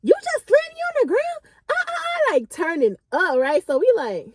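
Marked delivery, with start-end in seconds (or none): 0.0-2.3 high shrill mock outburst; going off. Friend on the ground going off, high mock outrage. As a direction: play her going off, pitched way up. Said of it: "K K like".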